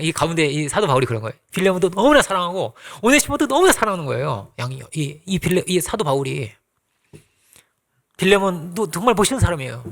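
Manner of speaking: normal talking voice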